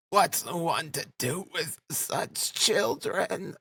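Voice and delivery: high, swooping exasperated lament; almost crying. A narrator's reading. Mother despairing to Rodion over her children, almost crying. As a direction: wail it slowly, near tears. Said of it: "almost crying herself".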